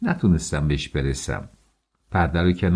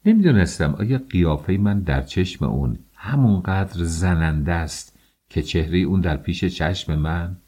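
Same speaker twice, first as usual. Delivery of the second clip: ordinary delivery, nothing marked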